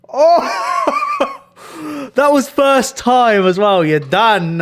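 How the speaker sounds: very high, loud laughing outburst; shrieking laughter. Real, not acted. After the coffee mishap, shrieking with laughter. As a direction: break into squealing laughter.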